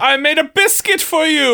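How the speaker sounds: high, proud stage knight; hammy stage actor. Acted. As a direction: offer it like a gallant hero. Knight announces himself.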